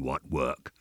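low, soft rising question; goblin's query. A narrator's reading. Goblin doubts the sexton's excuse, low voice.

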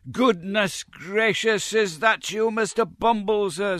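very high, slow, affected gush of joy; gushing Mrs Mann. A narrator's reading. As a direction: overdo the delighted welcome, high and drawn out. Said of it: "well-affected ecstasies of joy".

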